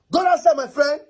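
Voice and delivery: high-pitched, exasperated command; sharp order. Acted. Husband ordering wife away from him, sharp high command. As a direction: send them away with a jump in pitch.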